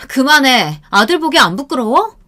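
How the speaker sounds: 높고 크게 나무라는 시아버지; 며느리를 호되게 꾸짖듯; 꾸짖음. Retold or imitated. 시아버지가 며느리를 나무람. (시아버지가 며느리에게 높게 꾸짖기)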